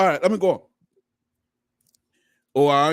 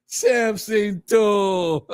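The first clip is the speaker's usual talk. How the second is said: laughing through words; much slower than usual. Real, not acted. Laughing while naming co-host.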